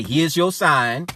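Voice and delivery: slow, loud proclamation; preacher announcing the sign; proclaiming loud. Real, not acted. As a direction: slowly call it out to the church. Preacher calling out to room.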